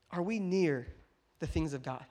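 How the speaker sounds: ordinary delivery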